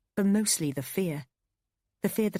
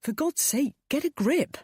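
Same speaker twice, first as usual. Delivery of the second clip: exasperated; 10 semitones higher, 4 dB louder than usual. A narrator's reading. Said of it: "said Adam".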